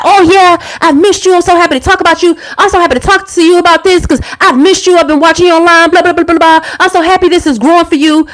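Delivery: high, mock-sweet imitation of her ex; sarcastic impression of an ex pretending to be happy for her; mocking ex's voice. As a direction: gush like a fake, fawning ex. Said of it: "He was like".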